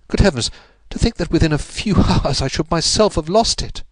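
a man who just realized he lost everything; despairing outburst. A narrator's reading. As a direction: voice breaking into a bitter laugh. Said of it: "distorted with a spasm of despair".

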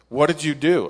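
mock an alarmed friend on the phone; alarmed demand. Tag calls, alarmed at him.

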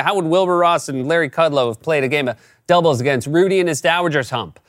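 normal talking voice

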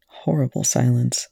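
ordinary delivery, nothing marked

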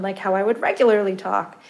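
ordinary talk, nothing marked

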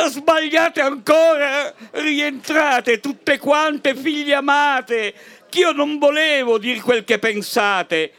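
high, hammed-up priest's cry, dragging out "rientrate tutte quante"; a flustered parish priest calling the women back; shrieking priest. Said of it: "il parroco strillò". (prete che strilla, un po' caricato)

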